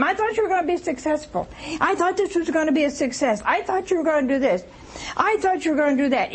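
high, fast nagging voice; nagging spouse. Retold or imitated. Mimicking a nagging wife at her husband, whiny and fast. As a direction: rattle off the complaints.

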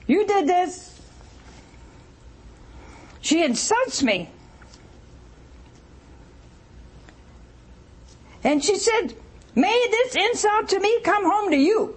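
high, scolding wife voice, hammed-up; nagging wife. Mimicking Sarah blaming her husband, mock scolding. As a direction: jab the blame at him.

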